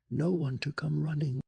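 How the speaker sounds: usual speaking voice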